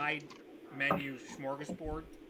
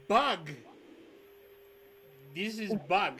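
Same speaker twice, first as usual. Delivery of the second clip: broken-English NPC; 3 semitones higher, 5 dB louder than usual. Acted. Host urging the guests to eat a bug, loud broken English. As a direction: insist like a host talking up a giant bug.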